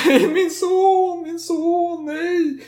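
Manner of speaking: very high, slow mock wail; hammed-up falsetto mother lamenting her son; shrieking fake despair. Worried mother wailing.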